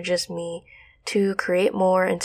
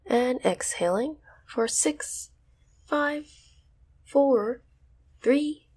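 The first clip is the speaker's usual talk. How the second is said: guided meditation; 4 semitones higher, slower than usual. Acted. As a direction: ease them into stillness. Counting breaths slowly.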